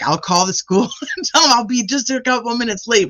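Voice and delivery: high-pitched, laughing insistence; retold pleading insistence. Wife arguing with husband to rescue a dog, high pleading. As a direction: plead your case in a high voice. Acted frantic plea to husband.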